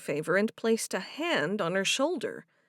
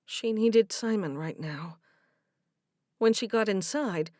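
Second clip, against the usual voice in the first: ordinary delivery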